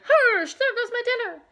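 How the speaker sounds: high, loud laughing yowl. A narrator's reading. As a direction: wail at the lost fish. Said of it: "Gus yowled".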